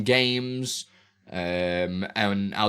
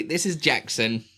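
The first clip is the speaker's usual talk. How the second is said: usual speaking voice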